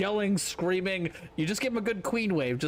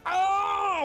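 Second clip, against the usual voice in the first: mock angry shout; 11 semitones higher, 3 dB louder than usual. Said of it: "if they're just like".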